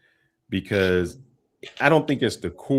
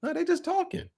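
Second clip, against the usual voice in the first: very high, softer brush-off; retold puzzled denial, pitched way up; mock dismissal; 5 dB quieter than usual. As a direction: wave it off in a squeaky-high voice. Retold puzzled reply defending his sisters.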